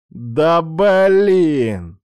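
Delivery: loud, stretched annoyed whine; drawn-out groan. Acted. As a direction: drag it out in annoyance. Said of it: "протянул Рома".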